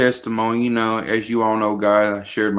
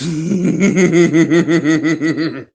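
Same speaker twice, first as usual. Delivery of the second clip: bursting laugh at own joke; hearty laughter; loud laugh; 5 semitones higher, 3 dB louder than usual. Real, not acted.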